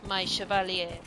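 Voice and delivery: "Chevalier" said the French way, shuh-val-YAY; a playful Orlesian French lilt on "Chevalier"; mock French accent. Shown as a demonstration. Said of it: "I love the Orlesian accent".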